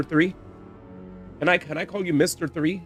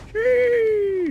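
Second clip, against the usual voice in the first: excited squeal; 6 dB louder than usual. Real, not acted. Excited at skin.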